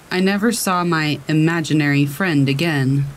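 ordinary delivery

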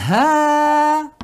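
first syllable of "Hada" held long, on a high level note; long vowel. Shown as a demonstration. Teacher shows how it should really be, long held vowel. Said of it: "tendría que ser así".

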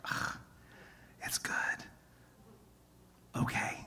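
grudging, whispered giving in; whispering that the daughter's pick is better; reluctant whisper. Retold or imitated. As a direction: admit it under your breath. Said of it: "And I was like".

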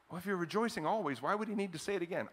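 usual speaking voice

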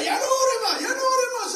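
high, shouted, whining taunt; the devil nagging the believer to stop praying, high shout; devil's taunt. Retold or imitated. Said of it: "cuando el diablo te diga".

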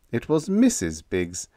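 ordinary delivery

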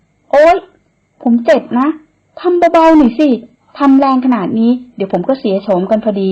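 loud high ouch then whiny protest; yelping complaint. Acted. Man yelps at woman dressing his wound roughly, loud. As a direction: yelp at her rough hands. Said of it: "ร้องโวยวายออกมา".

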